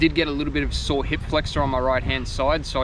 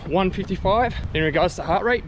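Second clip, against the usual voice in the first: out of breath; 4 semitones higher, 3 dB louder than usual. Real, not acted. Talking mid-run.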